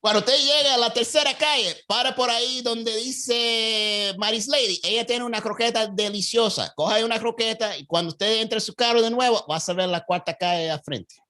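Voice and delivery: high Cuban Spanish, slowing on the name; Cuban accent. Retold or imitated. Cuban local sending a driver for a croqueta, high and warm. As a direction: recommend the croqueta stop, Cuban style. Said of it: "my Cuban accent was on point".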